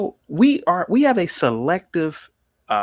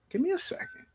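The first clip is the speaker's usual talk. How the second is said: much higher and much softer; hushed plea; 13 semitones higher, 12 dB quieter, faster than usual. Real, not acted. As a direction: drop to a hushed high plea for patience.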